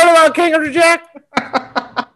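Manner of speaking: high, loud laughing promo voice; mock promo announcer. Host mocks the movie's ad, high laughing hype. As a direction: sell it like a cheesy trailer.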